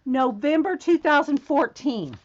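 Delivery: ordinary talk, nothing marked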